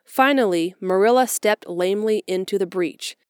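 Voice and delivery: ordinary delivery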